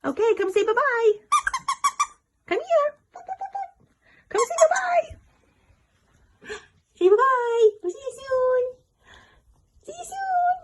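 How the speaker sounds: high sing-song pet voice. Real, not acted. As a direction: coo goodbye to the pet. Saying bye to pets, high voice.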